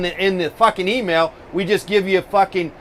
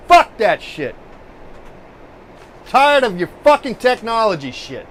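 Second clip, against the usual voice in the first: fed-up outburst; 5 semitones higher, 3 dB louder than usual. Real, not acted. Fed up with technology.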